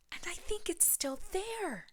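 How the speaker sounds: high little cub voice; small and high, half scared; Little Wee Bear. Acted. Cub warns his family something is in his bed, high.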